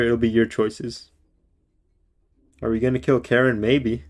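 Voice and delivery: ordinary delivery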